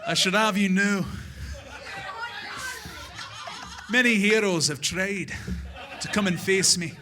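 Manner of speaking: put-on villain with a Scottish accent; menacing Scottish villain accent. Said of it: "He sounds a lot like William Wallace".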